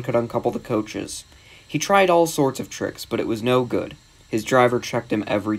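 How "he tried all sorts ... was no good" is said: ordinary delivery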